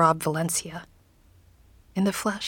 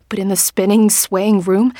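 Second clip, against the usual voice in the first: usual speaking voice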